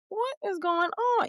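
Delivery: high but hushed mock bewilderment; baffled what. Outsiders baffled, imitated.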